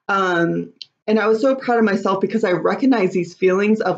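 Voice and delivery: ordinary talk, nothing marked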